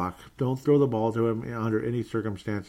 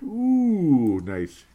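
big high, loud ooh; loud, high ooh of real glee; delighted ooh; 7 semitones higher, 6 dB louder than usual. Real, not acted. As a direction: swoop up in amused delight. Reacting to a funny comment.

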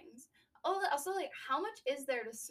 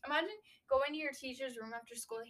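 ordinary talk

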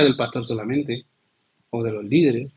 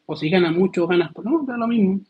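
dismissive shrug; 5 semitones higher than usual. Acted. Dismissive aside repeated.

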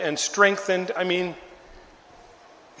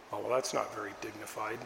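disapproval; 7 semitones lower, 12 dB quieter, faster than usual. Retold or imitated. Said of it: "people who were like".

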